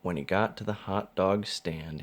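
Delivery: plain reading voice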